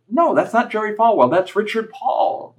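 insisting. Retold or imitated. Son insists back to mom.

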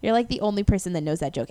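normal talking voice

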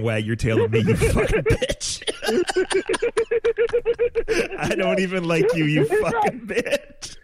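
high squeaky voice breaking into laughter, slowing on the last words; quoting dad through laughter. Retold or imitated. Retelling his dad cursing at the dog, laughing through it. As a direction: try to quote the line but keep cracking up. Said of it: "my dad ... talking to the dog, saying".

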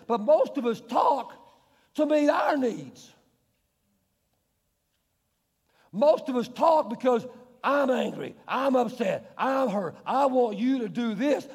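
loud, slow, driving sermon voice; forceful preaching. Real, not acted. Pastor pressing the point to his church, forceful preaching. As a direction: hammer home each phrase.

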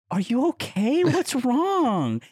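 high-pitched retold concern; high, babying check-in; concerned comforting. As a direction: coo worriedly at a crying friend. Tender concern for crying friend, retold.

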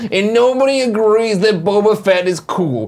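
slow, drawn-out whiny mocking impression; mock a co-host's sulky complaint, dragging each word; Nick. Co-host mocking colleague's failed smear campaign, slow sulky impression. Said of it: "now I'm Nick".

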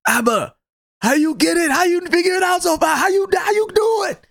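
high, rising, eager questioning voice; Steven asking. Retold or imitated. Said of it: "He's like".

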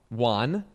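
"Juan" with an open ah vowel, low and matter-of-fact; own odd pronunciation. Shown as a demonstration. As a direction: say "Juan" for won, rhyming with John. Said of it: "I said Juan".

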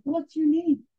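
very high, astonished question; retold awestruck question; stunned disbelief. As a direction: ask it way up high in amazement. Rabbi asks the surviving child.

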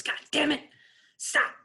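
high, sharp mock coach yell; coach's angry yell. Coach yelling at players mid-drill, angry. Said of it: "And he goes".

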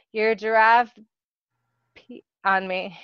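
halting mumble with a garbled word; mumbled, indecipherable. A narrator's reading. Coworker admits mishap to boss, mumbling. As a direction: swallow the middle word, trailing away. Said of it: "The last part was indecipherable".

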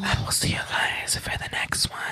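soft sign-off whisper. Real, not acted. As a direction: whisper goodbye.

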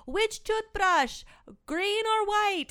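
high, slow, breezy question; sing-song question. Retold or imitated. Girlfriend calling down about toothbrushes, high and breezy. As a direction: ask it sweetly, oblivious. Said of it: "she's like".

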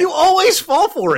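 high, loud laughing crow; gleeful gloating. Real, not acted. Co-host teasing friend who always falls for it, gleeful gloating. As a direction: crow it through laughter, voice shooting way up.

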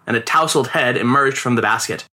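usual speaking voice

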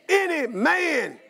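ordinary delivery

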